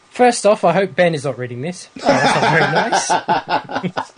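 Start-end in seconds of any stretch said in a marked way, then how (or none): 2.0-4.2 slow words through laughter; amused at the email; laughing. Real, not acted. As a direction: chuckle through mock offence.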